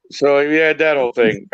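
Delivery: ordinary talk, nothing marked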